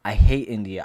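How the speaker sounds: a sudden loud jab at India; loud outburst. Real, not acted. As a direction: blurt it out hard. Ranting against India.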